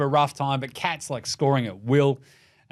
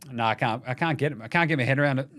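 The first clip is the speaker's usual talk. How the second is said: ordinary talk, nothing marked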